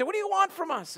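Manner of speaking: very high, loud exasperated demand. Acted. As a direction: cry out in frustration to God. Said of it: "the exasperated worshiper".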